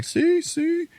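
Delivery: squeaky see see; piping up in mock triumph; high mock triumph. Dad proving his point to his wife, high and squeaky.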